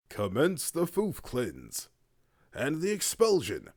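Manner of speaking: slow, loud, grandiose proclamation; dramatic loud announcement. Pretend. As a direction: boom it out like a decree. Mock-grand announcement of the expulsion.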